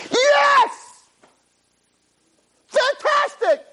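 high, loud cry of joy; joyful shout. Pretend. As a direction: shout it out in pure delight. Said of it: "when you say".

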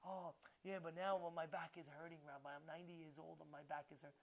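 low, quiet grumble about an aching back; soft, low-pitched moan of self-pity; old man complaining. Acted. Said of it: "he's complaining".

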